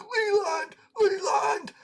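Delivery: very high, wailing name call; imitated yell. Wasted friend with face pressed on the glass, imitated high-pitched yell. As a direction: mimic a slobbering friend crying out a name. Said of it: "Who's yelling my name?".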